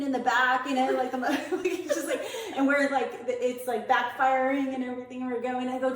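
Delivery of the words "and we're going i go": ordinary talk, nothing marked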